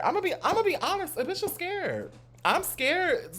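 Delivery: high squeaky scared voice; mock scared.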